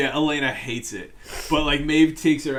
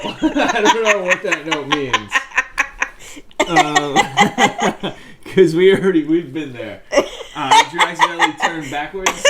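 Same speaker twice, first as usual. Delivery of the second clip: laughing hard, higher and louder; 4 semitones higher, 10 dB louder than usual. Real, not acted. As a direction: crack up while talking. Both crack up over notes.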